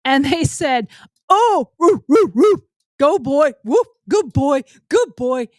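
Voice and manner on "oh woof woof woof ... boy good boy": loud, high jeering woofs; mocking woofs. The boy's own friends mocking him like a dog, loud and high. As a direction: bark and cheer at him like a dog.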